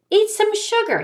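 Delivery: high-pitched storybook ghost; ghost giving advice. Acted. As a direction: pipe up with a remedy. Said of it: "he says".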